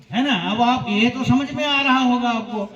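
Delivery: ordinary delivery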